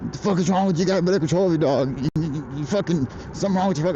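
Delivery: arguing with the man whose dog was off the leash, heated; retold angry yell. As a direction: throw it back at him, worked up. Retold shouting match with dog owner.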